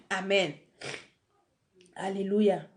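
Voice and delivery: ordinary delivery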